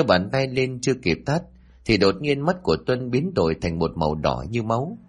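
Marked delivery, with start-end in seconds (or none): none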